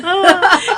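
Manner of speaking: loud high sobbing wail; acted child's cry; mock wail. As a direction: wail through hysterical tears, hammed-up. Child's wail in retold panic.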